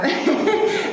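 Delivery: quick laugh shooting up high; short high chuckle. Real, not acted. Speaker laughing at his own quip, high laugh. Laughs after "not a joke.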